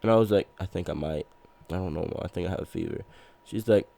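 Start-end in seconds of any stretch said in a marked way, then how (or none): 0.6-3.0 low, soft, feeble murmur; weak sick insisting. Retold or imitated. Telling mom about a fever, soft and weak. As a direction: imitate timid insisting while sick. Retold feverish reply to mother.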